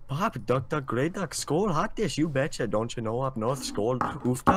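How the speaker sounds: hammed-up Minnesota word list, bouncing pitch; Minnesotan accent. Shown as a demonstration. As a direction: rattle off Minnesotan catchwords in exaggerated accent. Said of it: "you sound Irish now".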